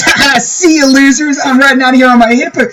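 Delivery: high, frantic shrieking villain taunt; wild unhinged ranting. Acted. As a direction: cackle and scream it like a fleeing madman. GM voices fleeing wizard taunting.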